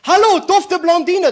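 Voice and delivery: high, slow, loud catcall; wolf-whistle call. Acted. Builders call down to a passing blonde with a loud high catcall. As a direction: call out to her from the scaffold.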